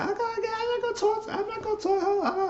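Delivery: very high quoted voice; squeaky put-on voice. Quoting her brushing off the call, high squeaky voice. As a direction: squeak it like a hurried excuse. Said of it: "And she said".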